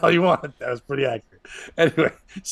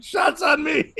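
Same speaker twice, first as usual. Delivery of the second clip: hammy comic shouting; 11 semitones higher, slower than usual. Retold or imitated. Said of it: "they're like".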